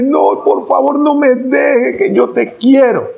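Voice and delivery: high, begging, tearful plea; pleading abuser. Retold or imitated. Abuser on his knees begging his wife to stay, high and mock tearful. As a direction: beg her not to leave, kneeling. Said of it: "va y se la arrodilla y le llora".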